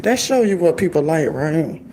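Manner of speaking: usual speaking voice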